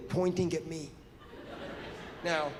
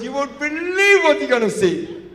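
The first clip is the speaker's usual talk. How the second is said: loud high secretive excitement; excited manager; 7 semitones higher, 11 dB louder than usual. Retold or imitated. Manager hinting at a surprise, loud and thrilled. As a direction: tease it, barely holding the secret in. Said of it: "He goes".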